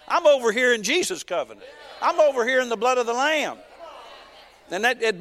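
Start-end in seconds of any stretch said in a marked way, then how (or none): 0.1-3.6 raised, higher preaching voice building in force; fervent declaration. Retold or imitated. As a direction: proclaim it with rising conviction.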